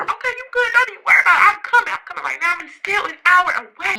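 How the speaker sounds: high, urgent reassurance; acting out a mom ready to cry; near-tears mom, imitated. Mom an hour away promising to come, imitated tearful urgency. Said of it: "My mom is ready to cry".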